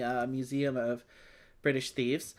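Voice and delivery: usual speaking voice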